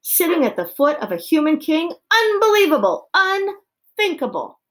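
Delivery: high, loud outburst; outraged shouting. A narrator's reading. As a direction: shout in scandalized disbelief. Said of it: "he shouted".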